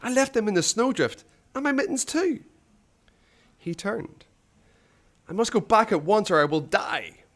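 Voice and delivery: high, rising panic; panicked. Acted. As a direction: fret aloud in mounting fear. Ivan panics about boots.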